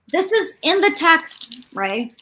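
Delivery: sarcastic. Real, not acted. Sarcastic about the book.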